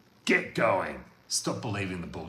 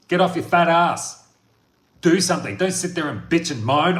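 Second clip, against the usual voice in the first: loud, higher barking drill-sergeant rant; David Goggins impression; 8 semitones higher, 11 dB louder than usual. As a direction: yell at them to get off their ass. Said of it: "He sits there and goes".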